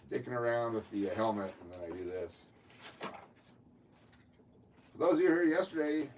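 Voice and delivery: distant, off-mic voice; speaking from across the room; far from mic. Real, not acted. Away from mic after spilling cards.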